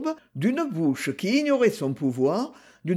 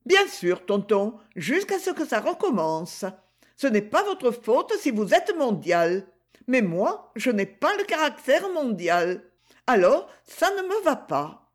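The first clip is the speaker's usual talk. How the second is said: put-on higher, lively girl's voice; higher, playful young girl; Gigi's voice; 5 semitones higher than usual. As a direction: play the teasing young girl greeting her uncle. Gigi voiced in read story.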